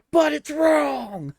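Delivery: high, loud, drawn-out cartoon scream; imitated scream. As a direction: scream it like an outraged cartoon character. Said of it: "And then he'd scream".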